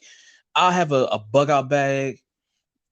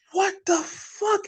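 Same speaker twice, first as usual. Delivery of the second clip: much higher, very slow, stretched-out disbelief; drawn-out disbelief; 14 semitones higher, much slower than usual. Retold or imitated. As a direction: stretch every word like you can't believe it. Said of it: "I'm like".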